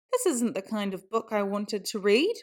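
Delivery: ordinary delivery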